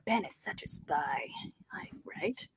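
hushed, voiceless whisper; conspiratorial whisper; whispered aside. Pretend. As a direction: whisper it like a secret. Confiding an aside about Ben.

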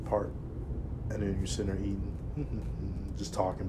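ordinary delivery

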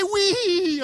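put-on very high delighted child's "Wee!"; gleeful kid squeal. Dad imitating his son enjoying the ride, very high gleeful squeal. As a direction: squeal with joy like a little boy. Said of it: "he'd say".

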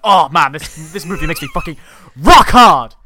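loud, high, gushing excitement; excited fan. Retold or imitated. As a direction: rave like a thrilled moviegoer. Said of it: "Cine World and be like".

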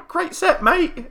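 high cheery shout; calling out. Retold or imitated. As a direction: holler praise after him.

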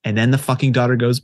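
ordinary talk, nothing marked

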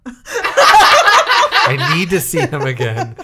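loud, high, drawn-out plea with laughter; hammed-up wail. Fan demanding a dead character's return, hammed-up wail. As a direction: wail it slowly, overdone.

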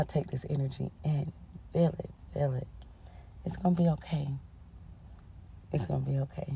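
real, quiet comforting tone; soothing reassurance. Real, not acted. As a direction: hush down to comfort the listener. Sending listeners a comforting hug.

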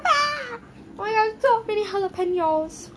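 high, startled cry at the jalapenos; dismayed yelp. Real, not acted. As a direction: squeal at all the jalapenos. Jalapenos on the pizza.

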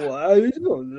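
loud, laughing punch-drunk impression; imitated punch-drunk boxer greeting; punch-drunk guy. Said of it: "that's punch drunk".

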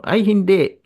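cold caller voice. Retold or imitated. To the nurse on the phone, a mock icy denial. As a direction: play the caller who won't admit being Filipino. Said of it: "malamig ang boses mo".

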